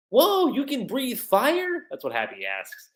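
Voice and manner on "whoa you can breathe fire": high, slow wide-eyed question; slow, high amazement; amazed. Acted. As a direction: draw out the wonder, pitched up. Acting Happy's amazed question.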